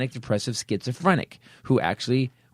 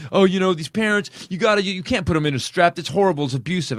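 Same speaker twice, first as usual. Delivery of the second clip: mocking news pundit; 5 semitones higher than usual. Mocking a woman on TV scolding parents, preachy and high. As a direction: parody the shocked do-gooder, tutting. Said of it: "a woman on the news talking about how".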